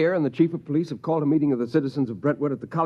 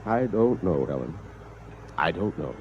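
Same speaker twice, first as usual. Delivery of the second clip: dejected; 12 semitones lower, 6 dB quieter than usual. Acted. Defeated after the massacre.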